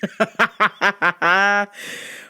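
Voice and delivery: long burst of staccato laughter; rolling, punched ha-ha laugh; hearty laugh. Real, not acted. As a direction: laugh it out after a boast. Laughs after his hyped boast.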